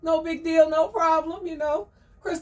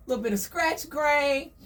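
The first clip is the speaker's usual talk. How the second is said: ordinary talk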